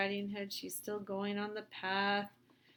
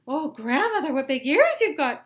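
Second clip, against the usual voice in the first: put-on high, loud startled girl; high, loud alarmed little girl voice; crying out girl; 6 semitones higher, 15 dB louder than usual. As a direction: cry it out.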